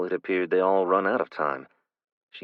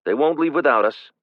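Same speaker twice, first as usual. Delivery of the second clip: calling over shoulder; 8 semitones higher, 7 dB louder than usual. A narrator's reading. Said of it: "he called over his shoulder".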